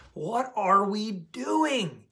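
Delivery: slow, level and pointed; slow rhetorical question. Real, not acted. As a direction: let each word sink in slowly. Repeats the question slowly.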